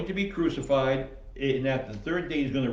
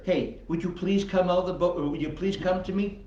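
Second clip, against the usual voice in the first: higher-pitched, polite request; mock-polite invitation, pitched up; polite asking; 5 semitones higher than usual. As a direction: ask sweetly, as a mere favour. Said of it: "just means ask him to come".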